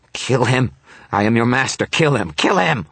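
high, loud, desperate command; frantic screamed order. A narrator's reading. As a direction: scream orders at a disobedient servant. Panicked master screaming orders at demon.

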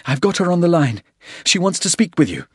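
high-pitched, urgent and breathless. A narrator's reading. Tannen hands Porter the sat phone under fire, breathlessly. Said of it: "she said breathlessly".

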